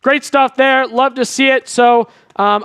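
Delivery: usual speaking voice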